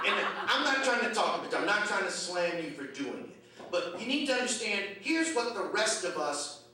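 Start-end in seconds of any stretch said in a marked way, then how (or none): none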